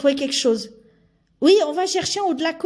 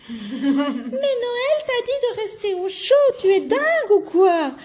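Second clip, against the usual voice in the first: put-on higher, girlish elf voice, scolding; a girl elf scolding her friend, voice pitched higher; 6 semitones higher than usual. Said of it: "j'ai oublié de faire la voix de fille".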